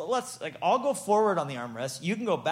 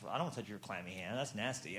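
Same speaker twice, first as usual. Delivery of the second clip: low, quick, much softer mutter; disgusted aside, lower and quiet; grossed-out mutter; 9 semitones lower, 12 dB quieter, faster than usual. Retold or imitated. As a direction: mutter it fast under your breath in disgust. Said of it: "I'd be like".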